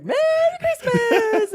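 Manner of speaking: high, loud, sing-song Merry Christmas; cheery greeting. Retold or imitated. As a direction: belt out a big happy greeting. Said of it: "he's like".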